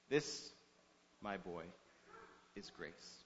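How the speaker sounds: hushed, low, with long pauses; soft, tender. A narrator's reading. Principal cradling the boy's face after the blows, softly. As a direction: say it very softly, letting it sink almost to nothing. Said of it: "the principal says softly".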